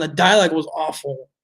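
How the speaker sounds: ordinary talk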